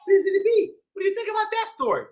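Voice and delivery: ordinary delivery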